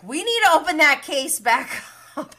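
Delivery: high, loud mock demand; acted loud demand. Imitating Lala on reopening the case, loud demand. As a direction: belt out the quote, breaking into a laugh.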